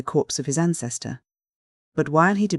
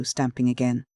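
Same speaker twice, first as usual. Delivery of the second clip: plain reading voice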